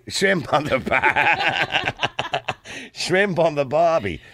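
put-on slow Aussie accent breaking into laughter; Australian accent. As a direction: draw it out in mock Australian. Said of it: "What about the Australian accent?".

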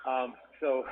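normal talking voice

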